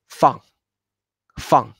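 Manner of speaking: short "Fan" dropping sharply from high; fourth tone. Shown as a demonstration. Teacher shows her name's second syllable, falling "Fan". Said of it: "también con tono cuatro".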